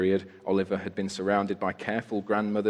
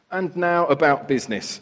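loud, high, self-important official; pompous beadle; 6 semitones higher, 6 dB louder than usual. A narrator's reading. Beadle Bumble speaks pompously.

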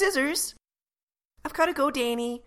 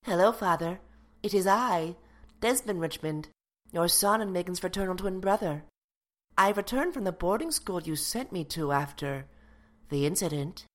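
lower, deadpan twin brother; son's voice; 6 semitones lower than usual. Acted. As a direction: drop the voice low and announce yourself. Twin brother introduces himself.

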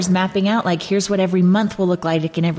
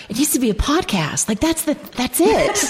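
excited retelling; 6 semitones higher than usual. Retold or imitated. Friend retelling her podcast revelation to Sarah, excited. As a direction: announce the big idea, pitched up and stretched. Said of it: "and I tell Sarah about".

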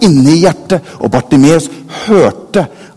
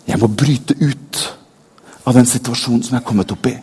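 quiet inner thought; 4 semitones lower, 5 dB quieter than usual. A narrator's reading. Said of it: "tenker i sitt stille sinn".

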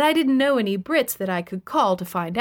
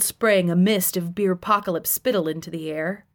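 ordinary delivery

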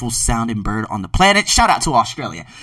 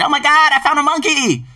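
high, loud mock-amazed exclamation; mock excitement; 11 semitones higher, 4 dB louder than usual. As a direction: imitate a gawker's thrilled outburst. Mocking racist online posts.

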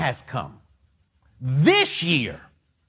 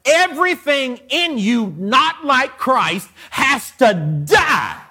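full-force preaching; 3 semitones higher, slower than usual. Acted. Preacher commanding his congregation to die to self, shouting. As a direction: pound every line at the church like a revival preacher.

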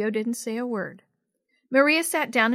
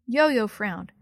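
ordinary delivery, nothing marked

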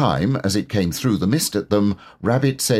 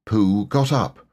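usual speaking voice

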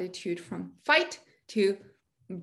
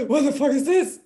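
louder, shocked demand; shocked outburst; 4 semitones higher, 7 dB louder than usual. Retold or imitated. Guest reliving a panic attack out of nowhere, shocked outburst. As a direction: blurt it out in alarm. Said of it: "And I was like".

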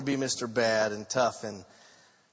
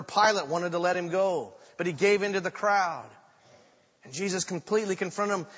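normal talking voice

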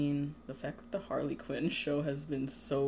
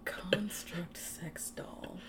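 whispered aside; 4 semitones higher, 8 dB quieter, slower than usual. Real, not acted. Awed aside after the joke.

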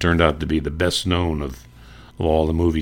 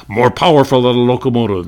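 much higher, louder booming radio intro; radio announcer; 4 semitones higher, 5 dB louder, faster than usual. Retold or imitated. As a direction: sell it like a golden-age announcer. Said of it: "something like this".

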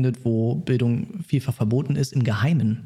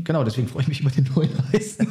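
laughing; 3 semitones higher, much faster than usual. Real, not acted. Joking about the cold tea.

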